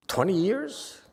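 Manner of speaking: high, mock-amazed "Twenty years?"; incredulous question. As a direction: act shocked at how long. Mocking the twenty-year practitioner.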